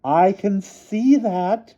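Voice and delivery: put-on high slow loud old woman's voice; cried. A narrator's reading. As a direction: cry it out in exasperation. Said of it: "cried the headmistress".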